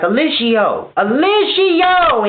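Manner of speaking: calling out. Retold or imitated. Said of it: "people talking to him like".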